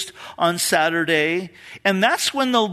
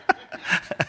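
breathy laugh; 4 dB quieter than usual. Real, not acted. Laughing at the coffee-shop coincidence.

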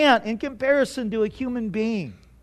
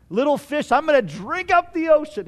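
higher-pitched little fish piping up; put-on little creature voice, pitched up; boastful little fish; 4 semitones higher than usual. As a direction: play a tiny fish making a big boast.

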